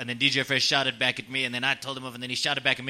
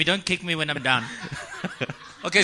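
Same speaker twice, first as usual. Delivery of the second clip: ordinary delivery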